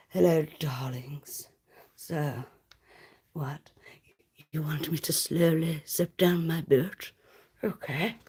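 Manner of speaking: slow seductive whisper to a caller; mock seductive phone worker teasing a caller; sultry phone-line voice. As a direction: purr it like a sex-line operator. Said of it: "we'll just do an impression".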